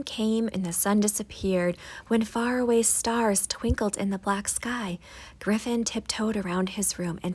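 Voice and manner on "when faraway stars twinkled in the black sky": ordinary delivery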